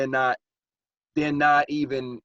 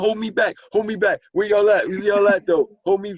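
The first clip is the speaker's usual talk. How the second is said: loud, high street tough picking a fight; wolf-ticket tough guy; 10 semitones higher, 8 dB louder than usual. Retold or imitated. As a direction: bark out the challenge like a bluffing tough guy. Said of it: "the selling wolf tickets".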